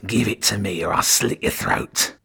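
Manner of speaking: lightly voiced raspy rat creature threatening; raspy spittle-laced rat. A narrator's reading. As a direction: spit the threat like a vicious rat. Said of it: "with a raspy, spittle-laced voice".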